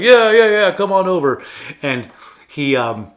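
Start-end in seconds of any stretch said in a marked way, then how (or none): 0.0-1.4 much louder, high-pitched holler; boisterous invitation. Retold or imitated. Paulie calling Rocky to come on over, loud and boisterous. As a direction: wave someone in with a big shouted welcome.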